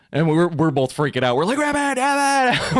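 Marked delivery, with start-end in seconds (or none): 1.6-2.7 much higher, slow, drawn-out calling. Retold or imitated. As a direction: call for the missing child. Parents calling for lost son.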